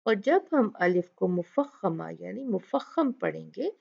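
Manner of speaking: ordinary talk, nothing marked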